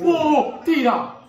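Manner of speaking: high, loud rising shout; goal scream. Real, not acted. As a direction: scream as the ball goes in. Fan shouts as goal comes.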